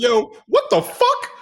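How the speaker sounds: high, drawn-out shocked reaction; reliving stunned disbelief in a high voice; retold disbelief. Retells his shocked reaction to drill instructor.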